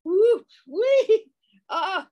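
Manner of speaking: very high, loud squeals of delight; high happy whoops ending in a laugh; joyful whooping. Acted. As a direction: shriek with splashing fun. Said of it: "they cried".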